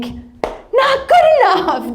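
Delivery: high, drawn-out objection; mock indignant protest. Wife rejecting her husband's faint praise, mock indignant.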